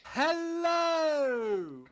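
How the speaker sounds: ordinary talk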